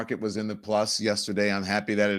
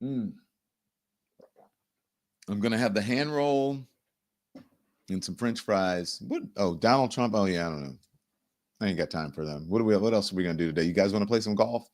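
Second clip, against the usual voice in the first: softer, casual and indifferent; acted unbothered; 3 semitones lower, 4 dB quieter than usual. Host playing China ignoring the president, acted unbothered. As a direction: play it as someone idly musing, completely unconcerned. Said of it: "They are unbothered".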